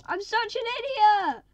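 high, loud, slowed self-reproach; anguished self-blame. Real, not acted. Player blaming himself for the parrot, loud anguished wail. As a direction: wail at your own mistake.

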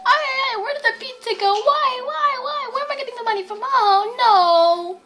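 high, wailing Italian-voiced mom. Mom fretting over the pizza money, high Italian wail. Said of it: "my mom is talking in a really strong Italian voice".

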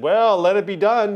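mock the easygoing grandpa with a shrug in the voice. Imitating his Minnesota grandpa, lightly folksy and loud. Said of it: "how my grandpa would have probably said".

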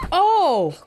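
high, loud startled cry; shocked cry. Real, not acted. Viewer sees a boy get hit, shocked cry. Shocked reaction to film fight.

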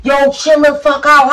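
high, loud intervening yell; retold shout. Boyfriend trying to stop the fight, imitated shouting.

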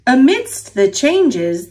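ordinary delivery

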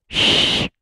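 a sharp shh for silence; shushing. A narrator's reading. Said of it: "shushed Mister Grumble".

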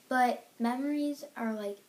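ordinary delivery

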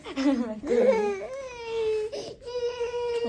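quiet breathy laugh; soft laugh. Real, not acted. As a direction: laugh softly. Mother laughing.

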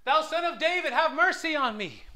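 high, loud plea for mercy; calling out. Retold or imitated. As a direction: cry out to be heard from the roadside. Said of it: "he called out".

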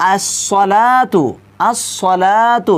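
আস-সালাতু said very slowly, লা held only one alif; slow demo of আস-সালাতু with a short লা; one alif length. Shown as a demonstration. Teacher models আস-সালাতু with a one-alif stretch. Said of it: "এখানে দেখুন".

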